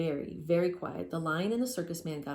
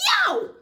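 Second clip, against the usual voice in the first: very high, loud yelp; the lion yelps, high and loud; yelp of pain; 12 dB louder than usual. Acted. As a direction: yelp from a scalding toe. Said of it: "he said".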